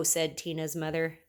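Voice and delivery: plain reading voice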